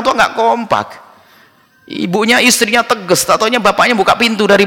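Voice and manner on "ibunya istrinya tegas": ordinary talk, nothing marked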